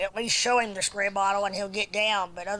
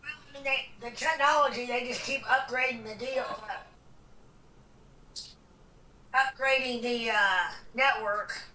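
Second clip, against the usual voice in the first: from across the room; 3 semitones higher than usual. Real, not acted. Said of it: "[name] from across the room".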